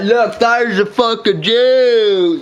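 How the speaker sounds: drawn-out sneering mock voice; very slow, sneering put-on voice; mocking bigots. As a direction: locals pointing him out, slow mock sneer. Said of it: "They're like".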